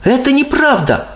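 put-on high, indignant girl voice; indignant Sophie. A narrator's reading. Sophie rejects the slur on grown-ups, indignant. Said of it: "возмутилась Софи".

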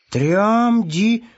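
ТРЯМДИ high, stretched, rising at the end; sounding out syllables. A narrator's reading. Hare reading the rag, ТРЯМДИ drawn out and rising. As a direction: labour through ТРЯМДИ, questioning. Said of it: "стал читать по складам тряпочку".

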